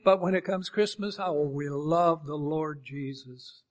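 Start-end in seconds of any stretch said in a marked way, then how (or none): 1.2-3.6 slow, drawn-out sanctimonious gush; put-on reverent crooning; mock piety. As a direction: imitate syrupy devotion, drawing out each word. Mimics pious Christmas celebrants.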